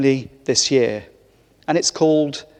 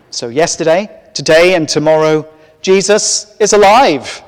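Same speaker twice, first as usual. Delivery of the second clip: triumphant proclamation; 2 semitones higher, 6 dB louder than usual. Real, not acted. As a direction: proclaim it like Easter joy, rising to the top.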